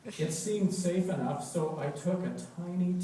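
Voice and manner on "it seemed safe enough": ordinary delivery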